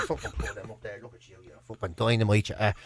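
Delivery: low, very soft sales patter; salesman's hushed flattery. Retold or imitated. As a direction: murmur compliments like a sly salesman. Salesmen flattering a customer in jocks.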